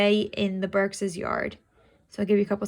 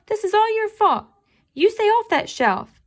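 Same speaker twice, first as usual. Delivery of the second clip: scolding, higher and louder; growling; 12 semitones higher, 7 dB louder than usual. Acted. Kiki scolds Toby for the shelf, a loud growl. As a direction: tell him off like an angry cat. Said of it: "I growled".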